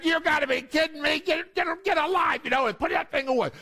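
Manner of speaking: high-pitched mock exasperation; exasperated mother. Mother refusing her daughter's pleas, acted exasperation. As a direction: act out a mom shutting the argument down.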